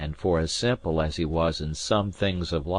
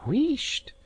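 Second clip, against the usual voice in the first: hushing maid. A narrator's reading. Maid hushes a Jacobite tune.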